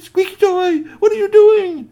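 very high, loud shout; shrill-pitched crowd outcry; alarmed calling. Acted. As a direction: yell in shocked disbelief. Crowd rushes out calling to him.

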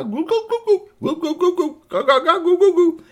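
very high, loud goo-goo babbling; baby talk babble. Acted. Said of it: "babbling to him in baby talk".

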